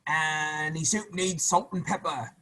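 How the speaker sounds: a crafty soldier dropping a hint; slow sly hint. Acted. Soldiers hinting while stirring.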